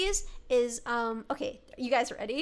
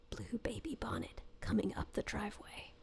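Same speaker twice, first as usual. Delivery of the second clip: hushed, lower spooky chant; slow, rhythmic whisper; 7 semitones lower, 15 dB quieter than usual. Shown as a demonstration. Retrying the ghost line to scare chat, spooky whisper. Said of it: "You hear it slow and rhythmically".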